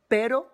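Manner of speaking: pero with a single light tap on the R; pero, one quick R tap; single tapped R. Shown as a demonstration. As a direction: tap the R in pero just once. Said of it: "il y a un seul R".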